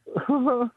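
high-pitched mock sobs; imitated pitiful crying. Storyteller imitates the crying little girl by the pond, pitiful mock crying. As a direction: imitate a little girl's whimpering cry. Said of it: "like, pitifully crying".

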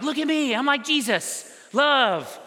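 hammed-up instant holiness after a pill; mock boast. Preacher imagines instant holiness after a pill, mock boast. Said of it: "all of a sudden it's like".

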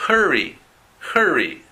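"hurry" with an untrilled English R. Shown as a demonstration. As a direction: say "hurry" as a clear model, R not rolled. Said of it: "hurry y r". (erre inglesa en "hurry", sin vibrar)

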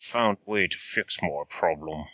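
low, lightly voiced Scottish Connery accent; a hint of Sean Connery, low and soft; mild Sean Connery impression. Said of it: "A little bit like Sean Connery there".

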